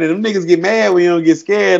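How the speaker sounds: ordinary talk, nothing marked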